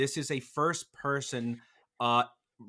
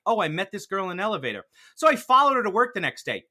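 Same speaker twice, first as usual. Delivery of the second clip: casual crazy person; 5 semitones higher, 6 dB louder, faster than usual. Retold or imitated. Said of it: "That's what this movie is like".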